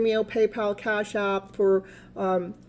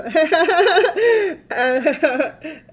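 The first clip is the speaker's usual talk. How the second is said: high, loud burst of giggles; real laughter; 7 semitones higher, 6 dB louder than usual. Real, not acted. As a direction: crack up in a long, helpless laugh. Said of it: "I'm just laughing".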